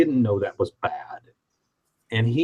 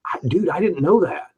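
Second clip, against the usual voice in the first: flustered protest; 5 semitones higher than usual. Retold or imitated. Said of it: "And I was like".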